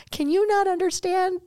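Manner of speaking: retold breakdown at the friend, voice shooting up; upset outburst. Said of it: "and I broke down".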